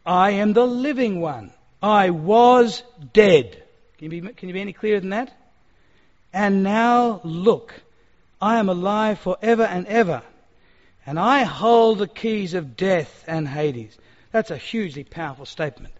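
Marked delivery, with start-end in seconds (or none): 0.1-3.6 very slow, loud, weighty proclamation; reading out scripture. Retold or imitated. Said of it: "he read out this statement from Jesus". 6.3-14.0 very slow, loud, weighty proclamation; reading out scripture. Retold or imitated. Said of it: "he read out this statement from Jesus".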